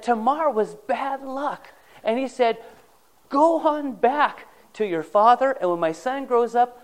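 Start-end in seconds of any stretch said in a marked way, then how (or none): 3.3-6.8 high and loud, sending her away; a patriarch dismissing his widowed daughter-in-law, loud; commanding. Retold or imitated. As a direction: order her back to her father's house. Judah sending Tamar home.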